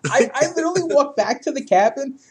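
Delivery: high, loud, laughing disbelief; helpless laughing retelling, drawn out; cracking up. Real, not acted. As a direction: struggle to get it out through real laughter. Cracking up at own story.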